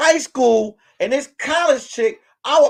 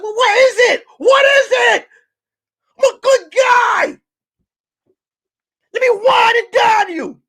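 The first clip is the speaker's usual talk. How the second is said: pleading much higher and louder, almost shouting; desperate high pleading; 11 semitones higher, 4 dB louder than usual. Retold or imitated. Rejected guy begging the girl, loud high desperate pleading. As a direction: beg her hard, voice climbing and loud. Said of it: "I said".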